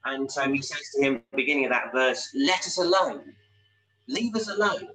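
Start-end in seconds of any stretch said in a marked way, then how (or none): 2.3-4.9 demon giving orders. Acted. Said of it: "Here's the demon giving Jesus orders".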